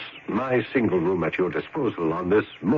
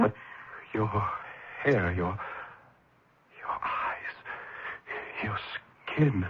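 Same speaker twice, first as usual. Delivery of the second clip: breathless awe; 6 dB quieter than usual. Acted. Awestruck at her pallor.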